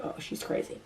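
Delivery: hushed whisper of friends; breathy whisper so she won't hear; whispered quote. Retold or imitated. Friends whisper in dark room.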